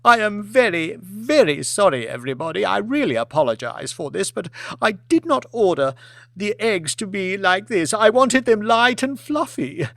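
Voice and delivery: theatrical apology. Acted. Lady apologising to the hall.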